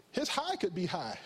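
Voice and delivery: ordinary talk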